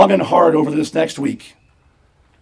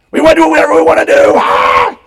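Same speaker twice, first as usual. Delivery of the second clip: high, fast, loud chant of defiance; hammed-up shouting; mock rebellious shout; 10 semitones higher, 7 dB louder, faster than usual. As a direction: yell it like unruly kids cutting loose. Mimics kids demanding total freedom.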